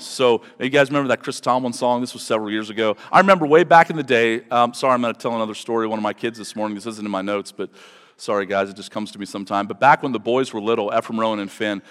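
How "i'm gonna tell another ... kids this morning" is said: ordinary talk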